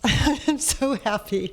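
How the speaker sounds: a real delighted laugh on the words; happy laugh. Real, not acted. To the councilmember staying on, laughing happily.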